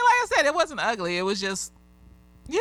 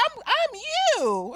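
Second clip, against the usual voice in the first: put-on high, drawn-out little-kid voice; sweet high-pitched child's reply; cute little girl; much slower than usual. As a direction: imitate an adorable small child, pitched way up. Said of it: "It was so cute how she said it".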